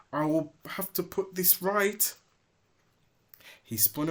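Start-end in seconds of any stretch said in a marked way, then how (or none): 0.1-2.2 angry vow. Acted. Kingsley vows.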